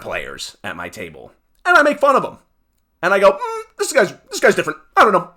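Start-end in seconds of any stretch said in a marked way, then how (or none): 3.3-5.3 high, mocking hum of doubt; mocking DM brushes off a rules complaint; mocking dismissive voice. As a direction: play the unimpressed DM teasing a player. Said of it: "I literally say it like that".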